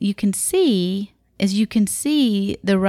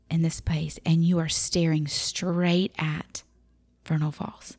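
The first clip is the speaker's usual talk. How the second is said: awed whisper; 5 dB quieter than usual. Acted. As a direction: breathe it out like a secret. Awe at first sight of falls.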